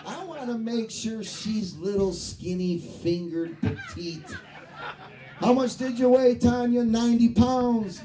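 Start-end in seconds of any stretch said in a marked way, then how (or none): 5.4-8.0 mock doctor question. Acting out doctor weight question.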